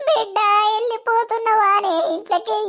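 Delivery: tearful farewell wail; crying. Acted. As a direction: wail it to a departing child. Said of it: "ఏడవకుర్రి".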